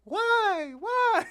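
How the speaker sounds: high, slow whiny wail; mock tearful high-pitched plea; mocking crying voter. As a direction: imitate her pleading sob. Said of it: "I'll tell you what happened at the end".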